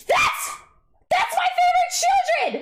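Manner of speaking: real squeal over a favorite card; overjoyed shout; ecstatic cry. Real, not acted. Pulls favorite card, cries out.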